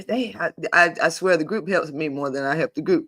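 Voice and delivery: ordinary talk, nothing marked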